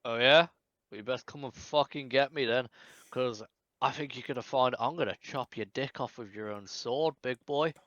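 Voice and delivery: taunting. Acted. Player taunts the villain.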